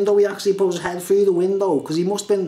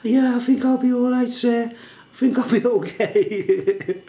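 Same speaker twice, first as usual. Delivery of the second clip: put-on much higher, slow, feeble voice of the beaten boy; dazed kid; 7 semitones higher than usual. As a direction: play the kid coming round, groggy and weak. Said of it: "He was like".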